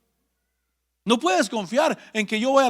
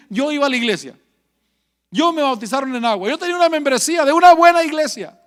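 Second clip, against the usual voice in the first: self-justifying plea; 5 semitones higher, 5 dB louder, faster than usual. Retold or imitated. People pleading religion on judgment day.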